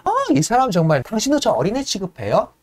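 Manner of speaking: offended, then insisting harder and harder; indignant heiress. Retold or imitated. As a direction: heiress scolding the servant for treating her like a child, rising to a demand. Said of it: "이렇게 얘기하는 거예요".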